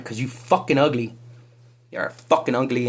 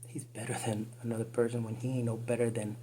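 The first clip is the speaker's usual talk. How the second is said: usual speaking voice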